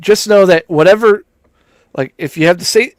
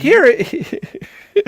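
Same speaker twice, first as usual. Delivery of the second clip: higher-pitched, peppy Northern US accent; upbeat Northern accent; 11 semitones higher than usual. Shown as a demonstration. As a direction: put an upbeat Northern lilt on it. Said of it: "Upbeat over here".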